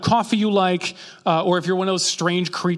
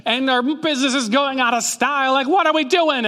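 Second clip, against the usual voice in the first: mock crowd shout; 7 semitones higher than usual.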